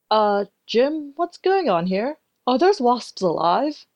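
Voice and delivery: a boss staring at bees, drawing it out in alarm; Caroline alarmed. A narrator's reading. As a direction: boss Caroline asking the narrator, slow and alarmed.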